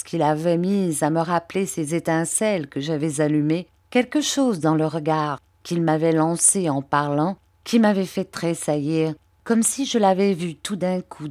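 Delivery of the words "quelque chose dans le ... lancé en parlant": usual speaking voice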